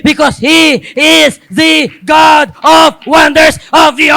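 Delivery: loud, rising, then a shouted climax; fervent pulpit crescendo; preaching at full force. Acted. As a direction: build the crowd up to a roar. Preaching build.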